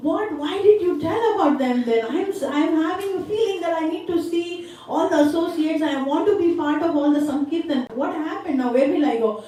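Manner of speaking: pitched high, urgent pleading questions; acted upset, high-voiced and insistent; distressed protest. Young devotee to his teacher on hearing they are gone, distressed.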